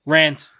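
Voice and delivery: "Rant" loud, with the 'a' of ant; short-A rant. Shown as a demonstration. Deciding how to say it, loud "Rant" rhyming with ant. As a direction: punch out "Rant" to rhyme with ant. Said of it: "I'm gonna say rant".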